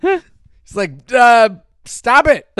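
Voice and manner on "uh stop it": loud, high, slow blurt; drawn-out "uh", then a loud improvised command; mock forgotten line. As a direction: imitate an actor groping for his line. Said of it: "improvising and he forgot his line".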